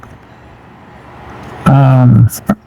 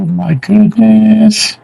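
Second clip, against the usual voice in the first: high, drawn-out astonishment; real amazement; 8 semitones higher, slower than usual. Real, not acted. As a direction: stretch it out high, amazed by the gift. Amazed by gift he unwraps.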